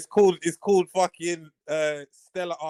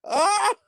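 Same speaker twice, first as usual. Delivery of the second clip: gleeful yelp; 7 dB louder than usual. Real, not acted. Gloating over the exposed guest.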